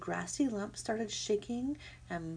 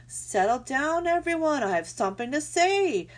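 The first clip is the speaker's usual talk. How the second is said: raised, loud owl calling a crowd to order; hooted call; 7 semitones higher, 7 dB louder than usual. A narrator's reading. Owl addressing the crowd from a branch, hooted loudly. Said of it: "Swooper the owl hooted to the crowd".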